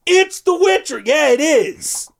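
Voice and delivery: high-pitched mock holler; mock announcing call. Announcing himself to the guards, mock announcing call. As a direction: announce yourself high, stretching the end.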